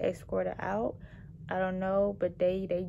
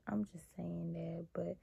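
whispered aside; 3 semitones lower, 9 dB quieter than usual. Real, not acted.

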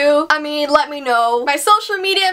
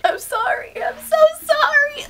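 mock crying; 11 semitones higher than usual. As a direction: fake sobbing apology, high and whiny. Mock sobbing apology to rude comment.

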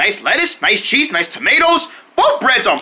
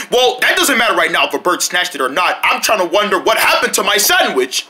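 normal talking voice

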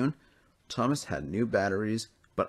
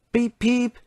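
high, loud toy-train beep; engine beep; 7 dB louder than usual. A narrator's reading. Thomas announces his arrival with a high beep. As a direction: toot like a little engine.

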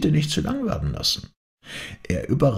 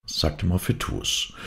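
plain reading voice

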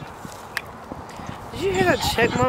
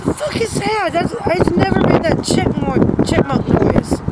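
startled shout; 7 semitones higher, 5 dB louder than usual. Real, not acted. Startled by a creepy app voice.

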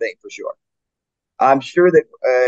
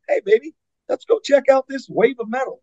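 high, far-off mock fan voice coaxing a friend; lightly voiced eager fan; imitating a fan; 13 semitones higher than usual. Fan coaxes partner to a metal show, mock eager.

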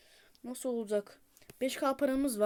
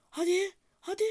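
1 eager urging; 10 semitones higher, 4 dB louder than usual. Real, not acted. Gamer urging game on.

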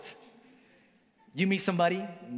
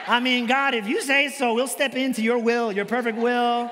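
louder, higher, hammed-up "if you say so"; mock holy surrender; 4 semitones higher, 6 dB louder than usual. Pastor acts out the couple giving in to God, hammed-up and loud. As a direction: play it as mock piety, half-shouted.

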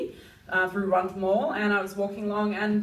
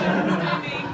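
normal talking voice

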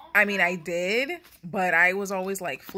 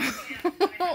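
high, teary giggle; a burst of moved laughter; emotional laugh; 11 semitones higher, 4 dB louder than usual. Real, not acted. Surprised by the signed note, a real giggle. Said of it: "I'm emotional".

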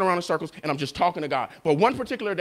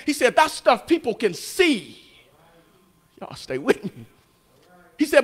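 God rebuking; 6 semitones higher, 4 dB louder than usual. Retold or imitated. Pastor voicing God rebuking him.

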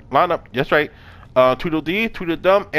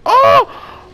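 sudden high loud yelp of delight; excited shout; 6 dB louder than usual. Real, not acted. Gamer yelping with joy as his helper pushes.

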